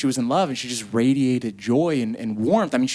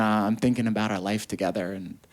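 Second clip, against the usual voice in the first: ordinary talk, nothing marked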